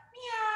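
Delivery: long, high Halloween-cat meow; kitty cat meow. Shown as a demonstration. Teacher rounding the spine like a cat, high meowing. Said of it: "the kitty cat".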